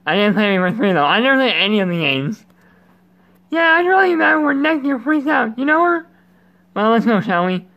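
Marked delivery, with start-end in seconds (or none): 3.5-6.1 high put-on voice; high playful character reading. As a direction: lift the voice way up for the character. Voicing game character.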